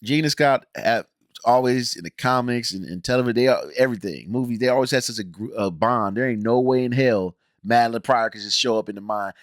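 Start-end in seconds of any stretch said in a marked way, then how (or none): none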